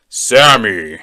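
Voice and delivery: loud, drawn-out deep call. Acted. Deep voice calls a third time, deep and loud. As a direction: boom the name out deep. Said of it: "hear this deep voice say my name".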